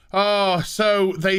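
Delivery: ordinary delivery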